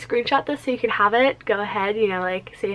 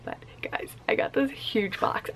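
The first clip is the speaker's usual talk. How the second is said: whisper; 9 dB quieter than usual. Real, not acted. Secret package found at door.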